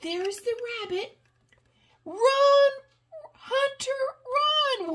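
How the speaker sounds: high mock alarm shout; alarmed cry. Grandma reading to grandchild, crying out in mock alarm. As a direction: warn the hunter in fun panic. Lion chases hunter.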